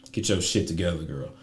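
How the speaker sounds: ordinary talk, nothing marked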